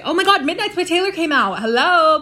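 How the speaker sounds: high, loud burst of excitement; excited gushing. Real, not acted. Fan announcing the Midnights album to viewers, excited gushing. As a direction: gush loudly about thrilling news. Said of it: "I'm so excited about that".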